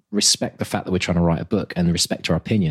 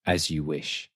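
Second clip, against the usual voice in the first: soft, low film-hero line; quiet, lowered movie-quote voice; Carrie Elwes impression; 5 semitones lower, 7 dB quieter, slower than usual. Shown as a demonstration. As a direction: say it hushed and low, like a romantic lead. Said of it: "I'll try it in my best".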